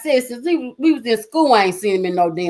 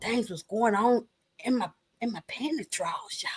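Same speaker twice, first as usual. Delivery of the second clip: hushed confession; 12 dB quieter than usual. Real, not acted. Telling viewers a naughty secret, whispered. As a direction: drop to a breathy whisper on the naughty bit.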